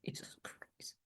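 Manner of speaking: whispered astonishment; awed whisper. Real, not acted. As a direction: breathe it out in real awe. Hears twenty-five years experience.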